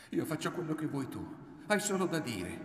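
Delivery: high, pressing offer; urgent pleading. A narrator's reading. Woman leaving the choice to her lover, urgent high plea.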